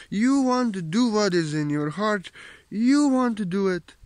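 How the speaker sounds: high, loud, ringing preacher's call; fervent preaching. Acted. Spiritual teacher rousing viewers to leave ego behind, in fervent preaching. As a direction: proclaim it to the whole hall.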